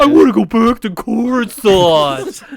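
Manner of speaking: put-on lightly whiny spoiled kid; a hint of a spoiled Padawan whining; whiny baby voice. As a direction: whine like a homesick child. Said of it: "He's a little whitey baby".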